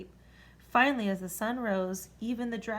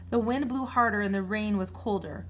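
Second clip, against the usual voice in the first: ordinary delivery, nothing marked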